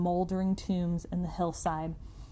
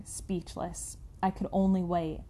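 plain reading voice

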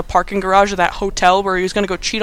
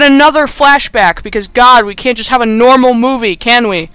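louder, higher, fed-up rant; exasperated; 4 semitones higher, 6 dB louder than usual. Real, not acted. Reviewer fed up with yet another flashback, exasperated. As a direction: complain in real exasperation.

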